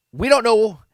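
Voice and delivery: mock upset, high and loud; freaking out. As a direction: imitate a worked-up outburst. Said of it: "He, he just freaks out".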